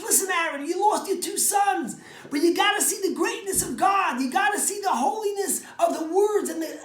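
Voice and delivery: high, loud urgent exhortation; fervent Moses. Acted. Moses urges his grieving brother to see God's greatness, high fervent preaching. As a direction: press the point on him, rising and loud.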